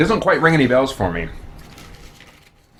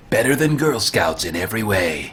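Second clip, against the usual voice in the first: announcer voice; 4 semitones lower than usual. Pretend. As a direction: pitch it like a commercial voiceover. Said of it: "That's an, an announcer voice".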